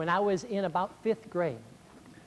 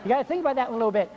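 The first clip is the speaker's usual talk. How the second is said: laughing; 5 semitones higher, much faster than usual. Real, not acted. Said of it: "laughs at own joke".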